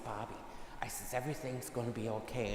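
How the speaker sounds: gentle reassurance. Retold or imitated. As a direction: softly tell him everything will be okay. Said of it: "I said".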